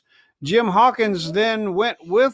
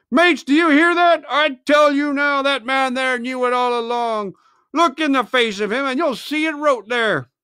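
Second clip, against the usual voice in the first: angry pirate; 5 semitones higher than usual. Acted. Mutineer turning the crew against Silver. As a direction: stir the crew against him.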